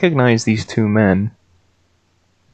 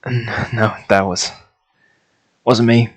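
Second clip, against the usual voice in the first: nervous denial; 2 semitones higher, 4 dB louder than usual. Acted. Guard denies under questioning.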